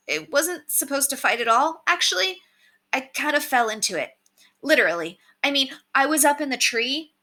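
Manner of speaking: a hint of an awkward boy downplaying his part; nervous young Curtis. A narrator's reading.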